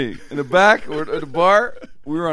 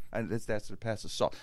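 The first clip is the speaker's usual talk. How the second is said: much softer, lower, sheepish excuse; sheepish explanation; 6 semitones lower, 13 dB quieter than usual. Acted. Diner explaining himself to the comic, sheepish. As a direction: shrink back and explain quietly.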